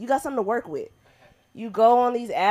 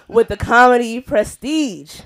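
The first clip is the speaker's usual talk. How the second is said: loud, rising announcer shout; hype introduction; 4 semitones higher, 14 dB louder than usual. Acted. Host introducing [name] to the crowd, loud hype. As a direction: bring the next act on big.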